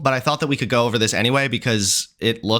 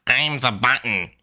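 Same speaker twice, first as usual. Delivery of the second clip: squeaky mock chipmunk; 2 semitones lower than usual. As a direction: mimic the squeaky button voice. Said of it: "sound like a chipmunk on crack".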